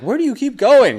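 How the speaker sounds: very high, loud questioning voice. Retold or imitated. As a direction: ask in a shrill high squeak. Partner voiced in bit.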